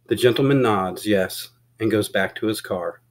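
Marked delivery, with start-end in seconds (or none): none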